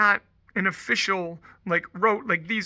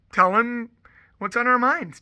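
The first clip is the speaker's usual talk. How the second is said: ordinary talk, nothing marked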